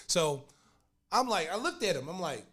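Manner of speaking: normal talking voice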